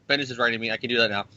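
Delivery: ordinary talk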